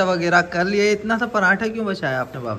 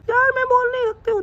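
high falsetto wife voice, a bit louder; mimicking wife; 3 dB louder than usual. Husband mimics his wife to the camera, high falsetto. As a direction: squeak it out like a pouty wife. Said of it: "mimicry कर रहा हूँ मैं".

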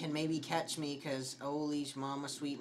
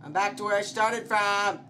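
loud, higher-pitched complaint; exasperated yell; 5 semitones higher, 7 dB louder than usual. Real, not acted. Player lost in game, exasperated yell. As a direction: cry out in dismay.

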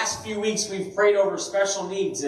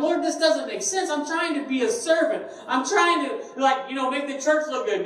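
aggrieved prayer; 8 semitones higher than usual. Retold or imitated. Complaining prayer about broken mower.

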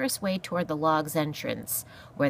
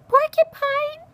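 very high, loud squeak; terrified echo; 8 dB louder, slower than usual. Acted. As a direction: squeak the word in horrified disbelief. Mouse echoes in terror.

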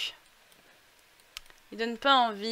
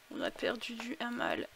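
ordinary talk, nothing marked

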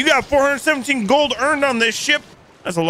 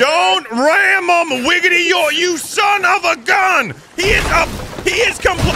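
mock yell at deputy; 9 semitones higher than usual. Sheriff yells at his deputy ramming the ship: mock outrage. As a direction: holler at the deputy to stop ramming.

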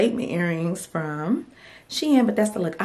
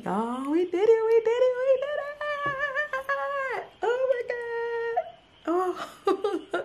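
builds from high to very high, thrilled; rising joy; 14 semitones higher than usual. Real, not acted. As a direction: climb higher with every repeat. Store launch succeeds.

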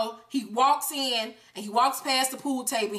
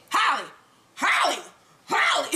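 building to a high shout of her name; calling out; 5 semitones higher than usual. Acted. Tommy calling dead Holly.

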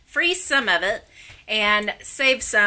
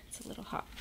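whispering; 16 dB quieter, much faster than usual. Real, not acted. Biscuit too hot, hushed.

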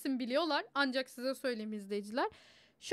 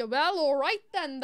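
slow, louder American-accented line, imitating the angry Toymaker; 7 dB louder, much slower than usual. Speaker imitating the angry Toymaker for friends, in an American accent. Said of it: "aşırı sinirlenince".

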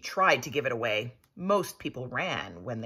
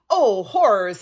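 much louder, aghast "Oh, horrors"; shocked cry; 4 semitones higher, 12 dB louder than usual. Acted. Prince recognises the lost baby's locket, shocked. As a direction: gasp it out in alarm.

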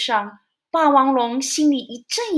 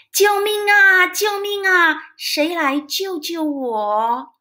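desperate call, fading slower; cry for help; 5 semitones higher, slower than usual. Acted. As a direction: yell for rescue while sinking. Drowning dinosaur calls for help.

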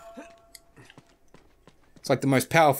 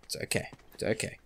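very soft, under the breath; self-soothing; 12 dB quieter, much faster than usual. Real, not acted. Player calming down after frustration, very soft reassurance. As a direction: drop low in volume to calm yourself.

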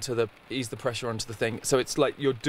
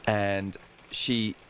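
ordinary talk

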